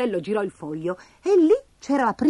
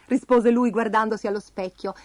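ordinary delivery

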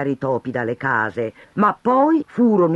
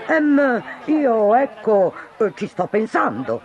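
hesitant mayor; 8 semitones higher than usual. Acted. Flustered mayor stalls the angry crowd, halting. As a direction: stall for time, much higher. Mayor caught without an answer.

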